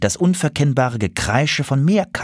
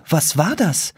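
higher, mildly alarmed question; distraught; 7 semitones higher than usual. A narrator's reading. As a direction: ask it startled. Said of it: "fragte der Bunte verstört".

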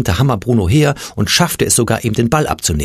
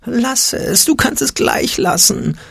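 high, slow, whimpering little fear voice; timid, slow, high whimper; whimpering Angstpeter; 14 semitones higher, slower than usual. A narrator's reading. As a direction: whine it like a frightened little goblin. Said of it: "wimmerte der Angstpeter".

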